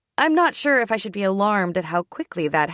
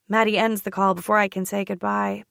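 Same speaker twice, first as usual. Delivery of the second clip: plain reading voice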